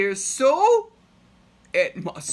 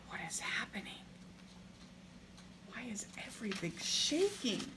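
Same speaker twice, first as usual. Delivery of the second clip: softer, whispered question; suspense whisper; 3 semitones higher, 13 dB quieter, faster than usual. Real, not acted. Reader asking children during the earthquake, whisper.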